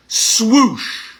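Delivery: breathy whooshing swipe noise; swoosh sound. Shown as a demonstration. Coach making a swoosh for the exercise. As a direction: sweep the image away with a whoosh.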